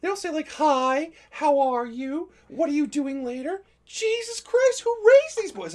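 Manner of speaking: put-on high, slow exasperated girl voice. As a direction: mimic a fed-up teen girl. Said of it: "this girl's like".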